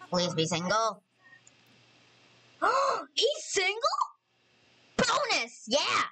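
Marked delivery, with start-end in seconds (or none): 2.6-3.8 gasp, then a high-pitched question; delighted surprise. Real, not acted. Player learning her Sim's crush is single, delighted surprise. 5.0-6.0 high-pitched celebratory cry; excited shout. Real, not acted. Player cheering that the crush is single, excited shout. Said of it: "Sorry, I shouted".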